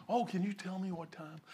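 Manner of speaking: mock soft, polite request; soft-spoken deceiver. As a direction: put on a gentle, quiet voice to deceive. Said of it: "Just because they speak soft".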